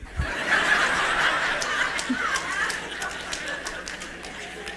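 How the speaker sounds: preacher laughing at his own joke; high breathy laugh. Real, not acted. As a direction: let the laugh run out breathlessly.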